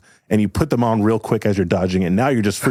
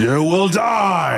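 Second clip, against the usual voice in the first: high, slow villain shout; villain roar; 10 semitones higher, much slower than usual. Acted. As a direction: bellow the threat. Villain roars in battle.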